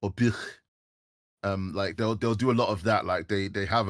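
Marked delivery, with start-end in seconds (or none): 0.1-0.4 "bich" with a phlegmy Scouse ch at the end; demoing Scouse "bich"; Liverpool pronunciation. Shown as a demonstration. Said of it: "they'll say".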